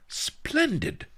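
put-on high, delighted old master; pleased, high-voiced cheer; happy. A narrator's reading. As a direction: exclaim it happily, pitched well up. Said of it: "Master Li said happily".

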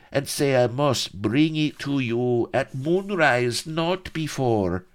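nervous Spanish prisoner fawning before the captain; fawning Spanish chamberlain. A narrator's reading. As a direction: grovel and wheedle nervously. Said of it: "the oily chamberlain fawned nervously".

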